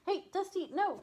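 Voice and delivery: sharp high call to the dog; scolding shout. Real, not acted. Scolding dog at litter box.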